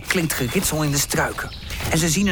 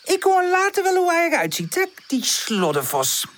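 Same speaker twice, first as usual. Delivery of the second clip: lazy; 13 semitones higher than usual. A narrator's reading. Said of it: "zegt Buurpoes lui".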